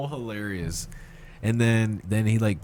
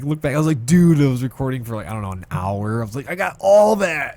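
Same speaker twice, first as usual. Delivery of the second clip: high, loud, thrilled retelling; retold excitement; 6 semitones higher, 8 dB louder than usual. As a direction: crow over it, drawing out the last words. Delighted he recorded it all.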